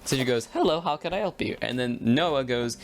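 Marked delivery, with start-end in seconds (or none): 0.5-1.6 lightly voiced office lady, higher and quicker; quick, raised receptionist hello, not drive-thru style; front office greeting. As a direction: answer briskly as the school front desk. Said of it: "not the fast food way".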